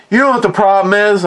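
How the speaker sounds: put-on the old man wants to vent, quick and pointed; gruff grandpa. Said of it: "my grandfather goes".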